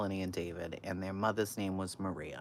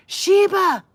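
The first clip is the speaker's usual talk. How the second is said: high, loud playful yell; yelled greeting; 17 dB louder than usual. Retold or imitated. Friend yelling their joke greeting in a store, loud. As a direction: call out across the grocery store. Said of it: "she would yell out".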